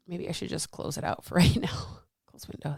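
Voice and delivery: half-voiced murmur, then a real whisper; whispered aside. Real, not acted. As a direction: whisper it to yourself while fixing a glitch. Muttering to herself closing window.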